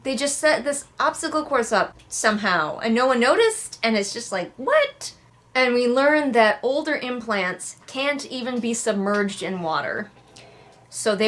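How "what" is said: high disbelieving squeak; what? in total disbelief, pitched way up; incredulous what. Real, not acted. Incredulous at the scenario.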